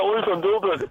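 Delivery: imitating a Dublin accent. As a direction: echo the Dublin brogue playfully. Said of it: "Did you hear that?".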